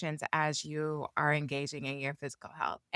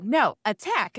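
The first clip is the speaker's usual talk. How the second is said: louder rallying call; mock coach pep-call; calling out attack; 5 semitones higher, 6 dB louder than usual. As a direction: urge the runners on. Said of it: "I'm like".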